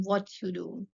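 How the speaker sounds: ordinary talk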